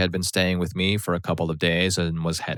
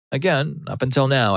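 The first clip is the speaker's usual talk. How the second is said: plain reading voice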